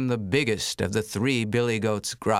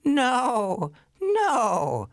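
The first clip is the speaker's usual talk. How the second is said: much higher, panicky goat; middle goat squeal; 13 semitones higher than usual. Acted. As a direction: yelp it like a scared goat. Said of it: "said the middle billy goat Gruff".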